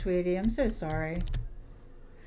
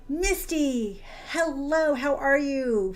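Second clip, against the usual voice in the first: chirpy greeting; 6 semitones higher, much slower than usual. Real, not acted. Greeting a chat viewer by name.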